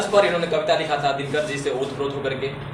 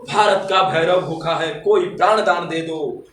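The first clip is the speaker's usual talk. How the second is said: high, louder declamation of patriotic verse; fiery poetry recitation; 8 semitones higher, 5 dB louder than usual. Acted. Poet rousing the audience to wake India, fiery declamation. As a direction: declaim it as a rousing call to arms.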